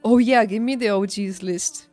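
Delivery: usual speaking voice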